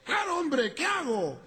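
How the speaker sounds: very high, urgent put-on voice; comic exasperated panic. Caller asks who should come, hammed-up frantic voice. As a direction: plead shrilly, stretching the final question.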